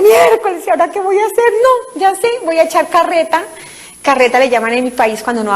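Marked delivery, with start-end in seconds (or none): 0.0-3.4 high, flustered inner panic; panicked thought. Retold or imitated. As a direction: scramble for an excuse, pitched up. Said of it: "yo mismo pensé".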